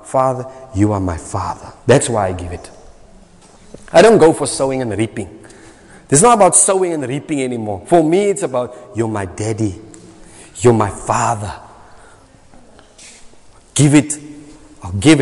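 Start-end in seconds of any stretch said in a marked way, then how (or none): 0.0-1.7 hushed and low, speaking to a father; soft, low, intimate address to God; tender prayer. Retold or imitated. Preacher voices his own prayer. 8.9-11.5 put-on softer and lower, like a child to his dad; hushed, affectionate address; tender prayer. Preacher voices his prayer to God.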